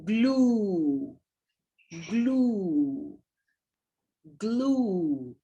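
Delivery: glue drawn out with a long u; stretched long vowel. Shown as a demonstration. Teacher modelling glue's long u for the class. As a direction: draw out the u in glue. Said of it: "the long vowel is".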